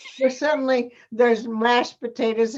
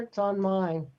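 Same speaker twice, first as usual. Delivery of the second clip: ordinary talk, nothing marked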